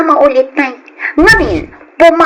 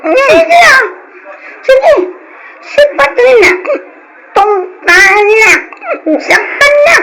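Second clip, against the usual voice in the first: daring him, voice raised; defiant yell; 9 semitones higher than usual. Acted. Sister defiantly shouting at brother. (makapungtot nga ikkis a makikarit)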